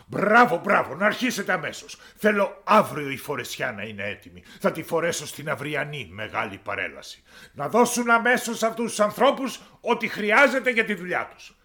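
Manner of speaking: high, loud royal orders; pompous king giving commands; commanding king. Acted. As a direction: bark out orders to the court. King orders work to begin.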